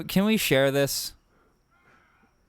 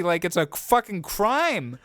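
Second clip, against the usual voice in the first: ordinary talk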